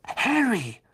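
a sudden idea lighting him up; boy calling his friend's name, thrilled; excited. A narrator's reading. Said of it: "sagte Ron mit leuchtenden Augen".

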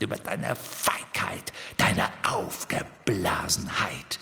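low, cold whispering sneer; mock him in a cold hiss; Voldemort's voice. Riddle-Harry taunting Ron in Voldemort's voice, low cold sneer. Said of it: "mit Voldemorts Stimme".

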